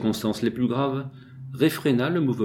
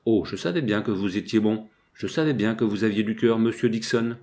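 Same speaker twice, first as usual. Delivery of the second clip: plain reading voice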